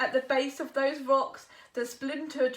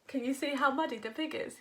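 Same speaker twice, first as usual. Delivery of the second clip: ordinary delivery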